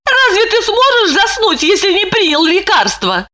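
high, laughing tease. A narrator's reading. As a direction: chuckle through the question. Wife teases husband.

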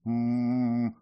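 stunned closed-mouth moan; stunned hum. A narrator's reading. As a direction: hum blankly, taken aback. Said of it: "ошарашенно промычала принцесса".

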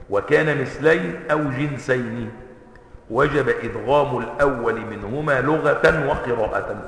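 soft, slow, measured recital of the verse; slow rule recitation. Shown as a demonstration. Teacher reciting the tajweed rule slowly.

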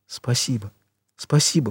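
soft, hushed thanks; whispered thanks. A narrator's reading. Granddaughter thanking her paralysed grandfather, murmured softly. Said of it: "прошептала она".

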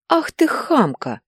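high, slow, outraged cry; flaring up. A narrator's reading. Natalya Ivanovna flares up at Nika in outrage. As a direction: blow up at the rude girl. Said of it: "вспыхнула Наталья Ивановна".

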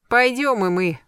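usual speaking voice

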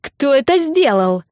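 high, loud, slow outraged question; furious demand. Acted. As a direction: demand to know who did it. Nina continues furious.

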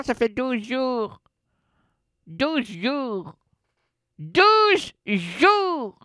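talking through a clenched jaw; barely opening the mouth; mâchoire bloquée. Real, not acted. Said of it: "je peux pas ouvrir la bouche". (parler sans ouvrir la bouche)

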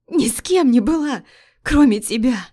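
wife crying to husband; sobbing cry. Acted. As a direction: sob out the denial. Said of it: "Рыдание".

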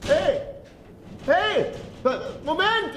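calling out. Acted. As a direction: shout after the man who just left him. Locked in, calling after Weimann.

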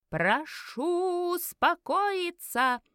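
high, long-drawn crowd-hushing shout; very slow, high-pitched town-crier yell; loud yell. A narrator's reading. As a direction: holler over a crowd, stretching every word. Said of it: "завопил громкий голос".

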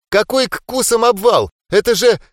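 high, loud indignant protest; indignant shout. Acted. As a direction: cut off mid-protest. Tola objects, cut off.

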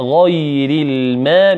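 غير الماء drawn out slowly with a heavy غ; careful teaching recitation; slow tajweed demo. Shown as a demonstration. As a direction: spell out غير الماء slowly, heavy غين and light ياء. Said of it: "الغين مفخمة والياء مرققة".

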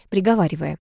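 usual speaking voice

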